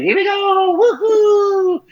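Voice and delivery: high, whooping Mario flying cry. Retold or imitated. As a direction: shout it like Mario in flight. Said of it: "My favorite thing is".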